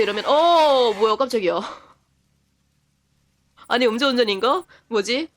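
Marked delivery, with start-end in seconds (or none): 0.3-1.6 mock startled reaction. Clerk reacting to a customer's odd radio remark, mock startled. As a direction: act out a jolt of surprise. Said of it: "근데 와가지고 갑자기".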